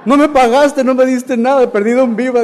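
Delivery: high, loud comic complaint; acted protest. Stand-in justifying himself to the town's official, loud protest. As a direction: protest loudly as the joke's punchline.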